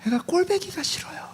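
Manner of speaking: high unvoiced whisper; whispered confession to God; whispered prayer. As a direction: breathe the secret prayer. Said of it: "기도하세요".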